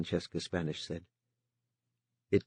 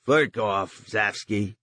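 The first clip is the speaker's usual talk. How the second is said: louder, annoyed brush-off; 2 semitones higher, 6 dB louder, much slower than usual. A narrator's reading. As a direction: swat him away irritably. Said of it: "said with annoyance".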